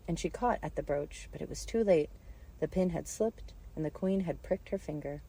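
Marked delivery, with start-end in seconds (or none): none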